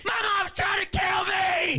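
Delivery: high, drawn-out terrified scream; hammed-up panicked shriek; screaming for help. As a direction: scream like a kid fleeing for his life. Replay of boy screaming.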